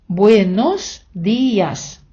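very slow, syllables drawn out; slow model phrase. Shown as a demonstration. Teacher showing students the masculine ending, very slow and drawn out. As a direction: stretch the Spanish phrase out for learners.

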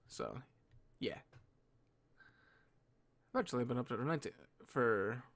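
drowsy, trailing off softly; sleepy voice, fading out; half awake. Real, not acted. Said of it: "I'm really tired".

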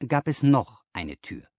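ordinary delivery, nothing marked

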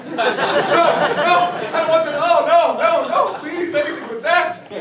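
high, loud, panicked begging; desperate pleading. Acted. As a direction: plead frantically, voice way up. Begging the genie in a dream.

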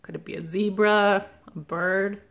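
usual speaking voice

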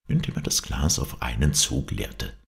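usual speaking voice

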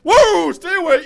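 high, very loud warning cry; startled shout. Real, not acted. Player reacting to sudden danger, startled shout. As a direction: shout it high, stretching out the alarm.

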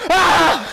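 startled scream. Real, not acted. Chased by monster in game.

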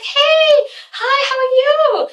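very high, drawn-out greeting; mock sugary high-pitched hellos; jovial and nice. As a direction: trill hello in an exaggeratedly friendly voice. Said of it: "comes through all jovial and nice".

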